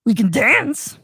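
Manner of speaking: creepy clown calling down the sewer; Pennywise impression. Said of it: "Pennywise luring Kermit".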